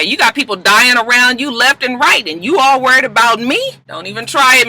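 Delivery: lightly acted fed-up mother; scolding mama. Mama scolding Annalise, retold.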